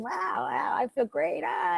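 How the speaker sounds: soft, quiet sigh of contentment; gently imitated bliss while shaking; blissful relief. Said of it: "And you go back to shaking".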